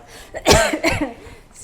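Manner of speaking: real coughing fit, choking a little. Real, not acted. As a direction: cough hard as food goes down the wrong way. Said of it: "Are you okay?".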